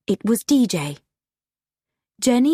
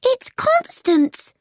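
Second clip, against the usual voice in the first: very high, drawn-out cry; frightened cry; slower than usual. A narrator's reading. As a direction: cry out in alarm, pitched way up. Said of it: "cried Jenny".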